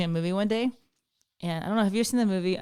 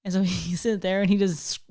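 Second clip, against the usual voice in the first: normal talking voice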